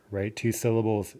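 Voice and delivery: ordinary talk, nothing marked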